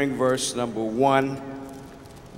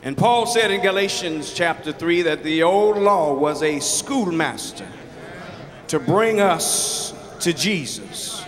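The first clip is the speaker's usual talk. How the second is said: rising preacher's cadence, higher, slowing at the end; building preaching; 6 semitones higher than usual. Acted. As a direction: lift the congregation toward the close.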